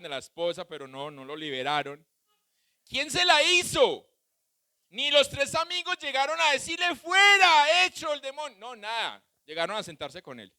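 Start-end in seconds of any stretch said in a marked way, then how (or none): 2.8-7.0 preaching at full force. Acted. Preacher challenges congregation loudly. 7.0-8.6 sudden high shout on Fuera, then dropping; high yelled exorcist command; mock exorcism shout. Acted casting-out shout.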